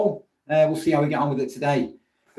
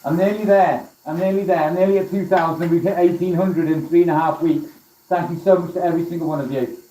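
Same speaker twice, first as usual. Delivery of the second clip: raised voice, muffled under a welding mask; 5 semitones higher than usual. Real, not acted. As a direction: talk up through a full face helmet. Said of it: "Can you hear me with my helmet on?".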